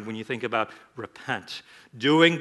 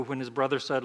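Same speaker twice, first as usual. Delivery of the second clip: usual speaking voice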